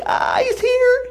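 put-on high, slow squeal of a girl; bad imitation of excited bride. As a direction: ham up a giddy girl spotting him. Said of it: "that was a bad imitation".

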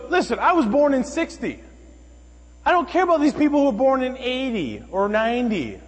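high, loud mimicry of an indignant stranger; exaggerated imitation of an entitled complainer; ranting woman.

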